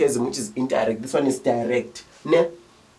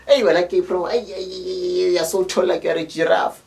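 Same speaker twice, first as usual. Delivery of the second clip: playground teasing; 5 semitones higher than usual. Retold or imitated. Kid mocking a tall friend, high taunting jeer.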